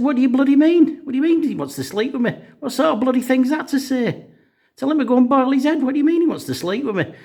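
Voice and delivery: high, loud, shrieking wife voice; indignant wife screeching at her husband; outraged wife. Acted.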